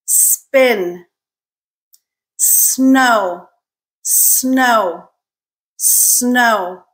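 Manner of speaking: Spin, Snow, Store each opening on a long clear S before the next consonant; careful, drawn-out S at the start of every word; S blend drill. Shown as a demonstration. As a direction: model each blend word alone, pausing after it. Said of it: "some examples of consonant blends".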